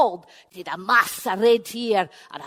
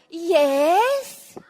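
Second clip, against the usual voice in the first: sweet innocent wife; 7 semitones higher, 4 dB louder than usual. Acted. Una answers door.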